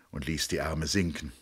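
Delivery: ordinary talk, nothing marked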